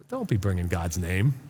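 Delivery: ordinary talk, nothing marked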